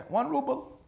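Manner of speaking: can't believe how cheap it is; surprised echo. Acted. Doctor hearing the price for the next life, surprised.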